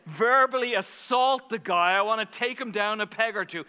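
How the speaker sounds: loud, high, worked-up; forceful, impassioned outburst; heated venting. Real, not acted. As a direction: let the indignation pour out. Venting about the man.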